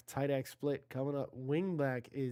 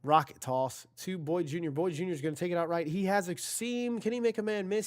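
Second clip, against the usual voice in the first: rising, faster call as the runner breaks free; excited play-by-play; 4 semitones higher, 3 dB louder than usual. Real, not acted. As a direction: build the hype as he hits the seam. Live call of a big run.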